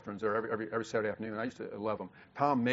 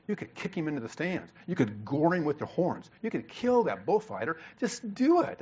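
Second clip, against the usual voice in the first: angry urging; 3 semitones higher than usual. Retold or imitated. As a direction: egg the bull on angrily, getting more heated. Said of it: "I really got angry".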